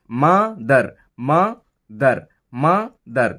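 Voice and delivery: "Mother" split as "মা ... দার" with a pause; each "mother" in two separated syllables; broken into syllables. Shown as a demonstration. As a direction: stop after "মা", then give "দার" its own beat. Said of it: "মা বলে একবার থামছি".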